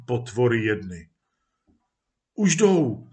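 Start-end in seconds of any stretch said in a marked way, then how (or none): 2.3-3.1 exclaiming. Acted. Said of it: "zvolal muž".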